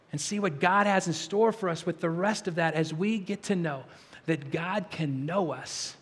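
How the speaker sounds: ordinary talk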